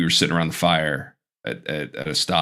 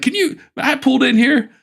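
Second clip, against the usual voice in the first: high-pitched comic working a noisy crowd; campground stand-up comic; 9 semitones higher than usual. Acted. Comic at a K O A starts his set, hammed-up high voice. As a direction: push the voice up like a hokey comic on stage. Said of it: "this guy who comes in".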